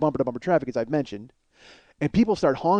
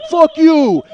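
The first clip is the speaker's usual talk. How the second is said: high, very loud retort; furious yell; 12 semitones higher, 12 dB louder than usual. Retold or imitated. Passenger starts yelling at the man from the car, furious shout. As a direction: explode at him. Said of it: "he starts yelling at me".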